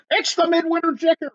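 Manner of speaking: high, sudden cry of alarm; high yelled warning from a man in a slicker. A narrator's reading. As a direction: shout it like a soaked stranger raising the alarm. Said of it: "he yelled".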